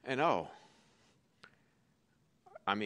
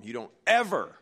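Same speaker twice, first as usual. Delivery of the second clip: louder retold warning with EVER punched; angry warning; 8 dB louder than usual. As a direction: warn the caller, hitting EVER hard. Retold angry warning to prank caller.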